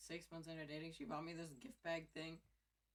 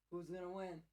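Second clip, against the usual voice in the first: ordinary delivery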